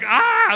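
high, loud frightened cry of ah; startled yelp. Retold or imitated. Player jolted by the masseur, loud high startled yelp. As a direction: yelp like you've been ambushed. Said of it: "I'm freaking out".

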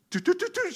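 high toot toot of a hung-up phone line; mimicked dial tone. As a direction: chirp it like a dead phone line.

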